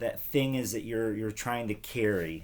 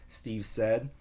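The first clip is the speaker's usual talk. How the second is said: usual speaking voice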